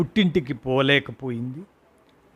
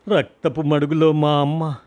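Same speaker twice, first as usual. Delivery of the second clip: ordinary delivery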